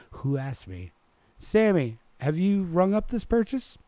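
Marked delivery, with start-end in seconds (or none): 1.4-3.8 high, loud nervous question; nervous manager. A narrator's reading. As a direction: press the cashier, rattled. Manager anxiously questions cashier.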